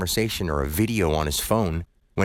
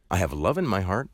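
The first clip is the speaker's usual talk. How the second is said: usual speaking voice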